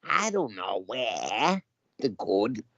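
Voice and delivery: put-on overdone Boston accent, slow and drawn out. English actor hamming a Boston accent, slow. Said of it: "They do it way too much".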